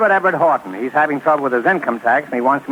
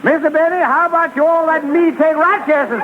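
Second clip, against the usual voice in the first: mock Rochester. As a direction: beg for the job as a comic Rochester. Mimics Rochester asking for job.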